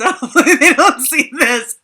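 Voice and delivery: said through loud laughter; laughing. Real, not acted. To the viewers about her parents, laughing loudly. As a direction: giggle the words out.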